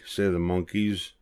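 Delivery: usual speaking voice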